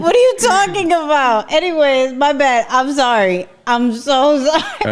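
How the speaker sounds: high giggly apology; laughing. Real, not acted.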